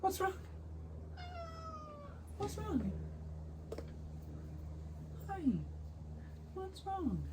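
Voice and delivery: very high, soft coo to a kitty; pet-talk voice. Real, not acted. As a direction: coo gently in a tiny high voice. Talking sweetly to a pet cat.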